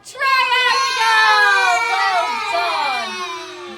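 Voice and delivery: loud, high cheering reveal; excited shout. Real, not acted. Teacher cheering the class for finding a triangle, loud excited shout. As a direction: shout it out to the whole class in triumph.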